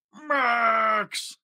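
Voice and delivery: high shouted call to Max; calling the dog. Acted. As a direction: yell for the dog across the cave. Grinch calling his dog.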